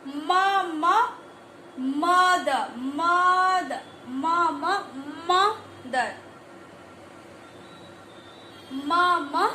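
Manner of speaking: "मा" and "मदर" stretched, leaning on the m; phonics sounding-out. Shown as a demonstration. Teacher sounding out mother for children, drawn-out "मदर". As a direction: draw out "मा, मा", then stretch "मदर". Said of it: "इस तरीके से".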